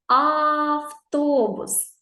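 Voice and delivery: very slow "Автобус" with a long level "А"; stretched first sound. Shown as a demonstration. Teacher showing the first sound, long "А" in "Автобус". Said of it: "Який перший звук".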